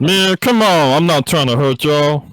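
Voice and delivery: loud, slangy frog; street frog voice. Acted. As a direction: make the frog tough and street.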